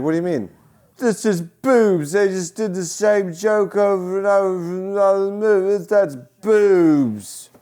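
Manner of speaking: high, loud, slow Ozzy voice; Ozzy impression. Quoting [name], explaining the joke in his loud voice. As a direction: drawl it like Ozzy. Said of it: "I'm like".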